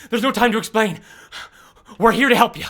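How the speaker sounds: loud, urgent call. Acted. Girl urgently tells Olive she is here to help, loud. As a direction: rush it out, there's no time. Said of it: "looks to you and goes".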